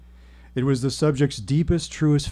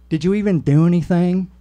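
put-on higher, louder Southern-accented challenge; vague Southern accent; 5 semitones higher, 6 dB louder than usual. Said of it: "a vaguely southern accent".

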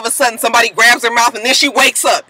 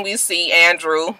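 ordinary talk, nothing marked

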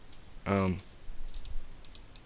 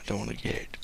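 usual speaking voice

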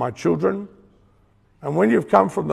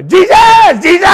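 much higher, much louder shouted Jesus, Jesus; mock street preacher shout; 16 dB louder, slower than usual. Imitating a street preacher yelling at passers-by, mock shout. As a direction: bellow the name like a fired-up street preacher. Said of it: "some would say".

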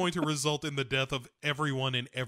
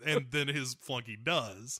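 ordinary talk

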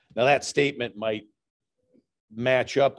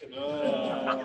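laughing; 2 semitones higher, 3 dB quieter than usual. Real, not acted. Laughs after punchline.

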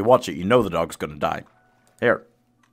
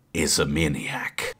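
slow whispered aside; 5 semitones lower, 4 dB quieter, much slower than usual. Real, not acted. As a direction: whisper it like a secret warning. Introducing friend as maniac.

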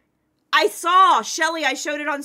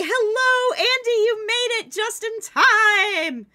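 silly high greeting voice; 10 semitones higher, 6 dB louder than usual. Pretend. Greeting a friend in a voice.

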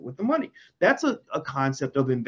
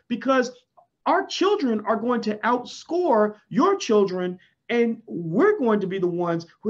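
fired up; 7 semitones higher than usual. Real, not acted. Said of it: "I'm getting emotional about this".

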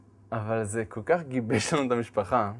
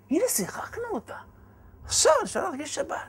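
much higher, gleeful; we pulled it off!; delighted. Retold or imitated. Husband rejoicing that Shabbat is ready, delighted.

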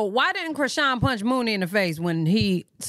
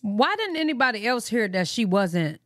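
usual speaking voice

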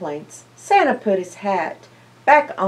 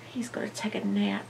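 soft quick whisper; fast secret whisper to listeners; 13 dB quieter, faster than usual. Acted. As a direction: hush so the cub can sleep. Cub curls up to sleep.

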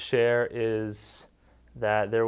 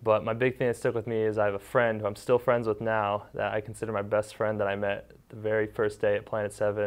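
ordinary talk, nothing marked

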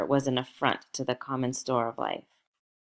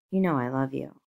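plain reading voice

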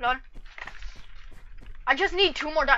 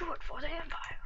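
whispered Star Wars line; soft whispered quote; 12 dB quieter than usual. Retold or imitated. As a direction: breathe it conspiratorially for the Empire.

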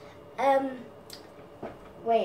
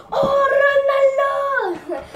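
much higher, loud shout; celebration scream; 13 semitones higher, 10 dB louder, much slower than usual. Acted. Gamer impression screaming at a goal. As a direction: scream the name like a goal just went in.

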